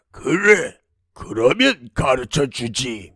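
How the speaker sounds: measured demon voice, each detail spelled out; clearly explaining demon. Acted. Demon tells princess where his heart lies, clearly. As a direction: reveal the secret piece by piece, with relish. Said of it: "똑똑히 알려주었습니다".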